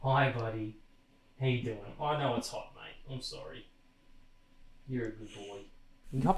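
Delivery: soft, hushed cooing to a pet; calm the cat down gently, almost whispering; soothing the cat. Real, not acted. Comforting the hot cat on his lap, softly.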